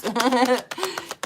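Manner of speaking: high giggling laugh; short real burst of laughter; cracking up. Real, not acted. Streamer amused by "sexual vibes", high laugh. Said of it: "I am cracking up".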